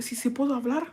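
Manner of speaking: high, soft, timid Spanish question; mock meek victim. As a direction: pretend to timidly ask permission. Playing the victim card in therapy.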